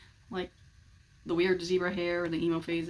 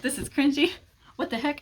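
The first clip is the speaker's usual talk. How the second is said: higher, louder mock disgust; cringing disgust; 7 semitones higher, 5 dB louder than usual. Kid judging a shirtless cover, scoffing.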